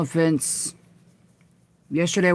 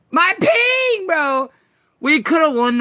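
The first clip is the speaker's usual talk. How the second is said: high frustrated outburst; hyped gamer bro; 10 semitones higher, 4 dB louder, slower than usual. Real, not acted. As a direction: yell it at the lag. Frustrated at lag.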